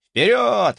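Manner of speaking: high loud cheering forward; rally them with joy; excited cheer. Acted. Excited viewer chat message.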